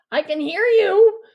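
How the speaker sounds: loud, high, cracking shout; boy shouting over, voice cracking. A narrator's reading. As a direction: yell it from the tree. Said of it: "Deke shouts, his voice cracking".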